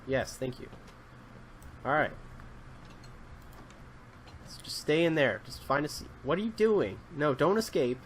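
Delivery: normal talking voice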